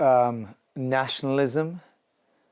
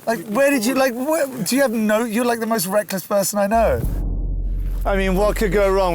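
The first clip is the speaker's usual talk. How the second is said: high, loud exasperation; exasperated; 8 semitones higher, 5 dB louder than usual. Real, not acted. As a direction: scold him for being reckless. Mock scolding reckless friend.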